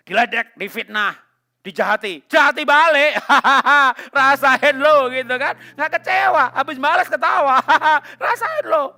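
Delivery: loud, high, laughing taunt; mocking a gleeful avenger, loud and high; gloating revenge. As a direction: cackle while jeering Rasain lo. Mocking non-Christians taking revenge.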